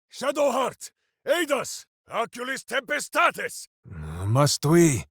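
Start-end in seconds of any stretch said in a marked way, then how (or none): 0.1-1.9 calling out for help. Acted. Warrior calling to Shadowheart mid-battle, shouting for help. 2.1-3.7 shouted incantation. Acted. Casting a spell.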